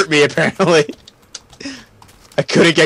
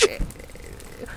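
whisper; 15 dB quieter, much slower than usual. Real, not acted. Whispered aside.

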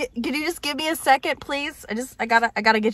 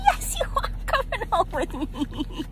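puppy talk; 10 semitones higher, 3 dB louder than usual. Real, not acted. Telling her new puppy it is coming home, gushing high baby talk. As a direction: gush over a tiny puppy in a squeaky-high voice.

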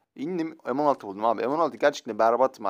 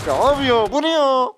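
high, loud disbelief; outraged surprise; 11 semitones higher, 8 dB louder than usual. Real, not acted. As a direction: cry out in disbelief. Outraged at a lucky kill. (yüksek sesle şaşkınlık)

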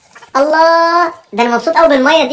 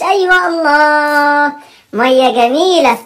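slow savouring; much slower than usual. Acted. Dad enjoying daughter's water spray.

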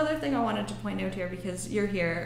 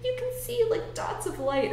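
put-on much higher little-kid voice; child voice; 13 semitones higher than usual. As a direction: play a small excited child. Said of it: "they're like".

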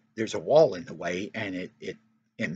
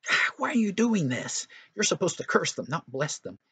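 exasperated Balak; 7 semitones higher than usual. Acted. Balak complains to Balaam.

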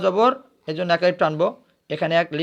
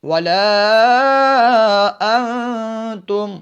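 very slow chant with long held notes, rising higher; Quranic recitation; 5 semitones higher, 5 dB louder, much slower than usual. Shown as a demonstration. Teacher reciting the verse for the class, slow melodic chant. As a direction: draw each word out like a tajweed reciter. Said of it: "আমরা বলবো".

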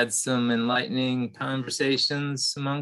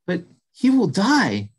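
higher, louder alarmed thought; worried mouse; 7 semitones higher, 7 dB louder than usual. Acted. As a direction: fret aloud like a frightened mouse.

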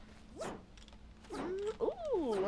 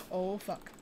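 ordinary talk, nothing marked

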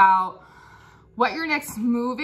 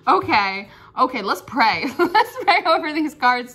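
normal talking voice

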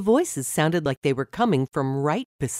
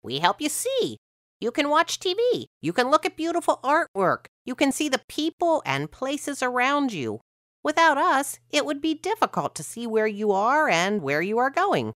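much higher, bright little eyes voice; the eyes boasting in a much higher voice; eyes chiming in; 10 semitones higher than usual. A narrator's reading. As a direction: pipe up high and chirpy as the eyes. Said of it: "my eyes chimed in".

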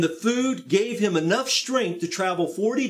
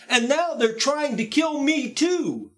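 Elijah's anguished complaint; 8 semitones higher than usual. Acted. Elijah's complaint continues higher.

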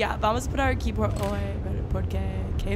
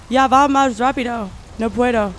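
panicked shout; 9 dB louder than usual. Real, not acted. Monster gaining on her.

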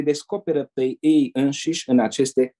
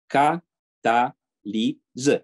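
cataliză stretched very slowly with a rise; mocking engineer drawling cataliză; slow drawn-out word; much slower than usual. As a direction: spell out cataliză syllable by syllable as if obvious. Engineer says just tell him.

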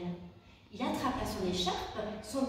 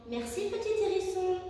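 thankful mice; 8 semitones higher than usual. A narrator's reading. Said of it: "dirent-elles".

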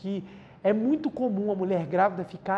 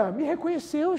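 higher, voice lifting with emotion; overwhelmed father's delight; high moved exclamation; moved joy; 8 semitones higher than usual. Retold or imitated. Baby stops crying at his voice.

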